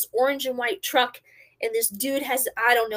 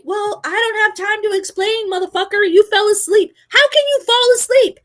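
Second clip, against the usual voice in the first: high, angry tirade building louder; freaked-out yelling; 11 semitones higher, 7 dB louder than usual. Retold or imitated. Driver to TC who fell asleep, furious high yelling. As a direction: lay into them, climbing to a yell. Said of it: "I'm freaking out".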